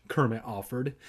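usual speaking voice

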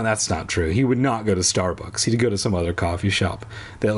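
usual speaking voice

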